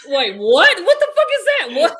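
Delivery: high, loud disbelieving laugh; laughing shock. Real, not acted. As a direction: shout it, baffled and cracking up. Baffled by grandma saying.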